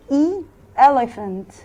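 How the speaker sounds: elephant drawn out slowly, first e short and open; slowly modelling elephant for the class; slow model word. Shown as a demonstration. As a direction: stretch out elephant, stress the first e. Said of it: "عشان حرف الإي ساوند بتاعه اسمه أ".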